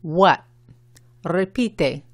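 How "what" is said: "What" with the final T left unpronounced; unreleased final T. Shown as a demonstration. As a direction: say "What" with a slight U before a long A, T dropped. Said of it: "la T cuando es al final de la palabra".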